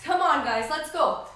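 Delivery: loud, punchy push to keep going; rallying cheer. Real, not acted. Trainer spurring on the class for the final exercise, rallying cheer.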